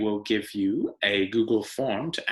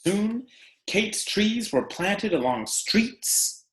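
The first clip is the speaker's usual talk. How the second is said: bright storybook read-aloud, pitched up and unhurried; animated picture-book narration; reading to children; 5 semitones higher than usual. Acted. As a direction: read it to a class of kids, lively and clear. Teacher reads picture book aloud.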